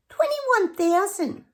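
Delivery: high, loud, drawn-out disbelief; incredulous exclamation. Real, not acted. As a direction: exclaim it slowly, way up high, appalled by the figure. Figure repeated, exclaimed in disbelief.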